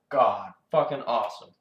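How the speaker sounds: ordinary talk, nothing marked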